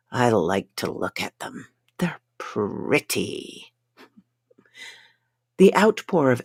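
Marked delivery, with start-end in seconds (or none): 0.1-3.7 low, slow, whispered awe; dreamy murmur. A narrator's reading. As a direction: drift off, entranced. Dazed man admires illusions.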